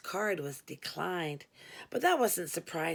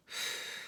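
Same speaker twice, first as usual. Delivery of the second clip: soft unvoiced sigh; weary sigh; 10 dB quieter than usual. A narrator's reading. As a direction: sigh heavily. Weary mid-script.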